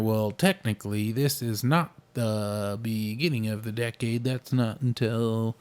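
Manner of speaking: lightly mock the pedantic "technically"; pedantic know-it-all. To friend, lightly mocking pedants about the decade. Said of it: "People, uh, saying that".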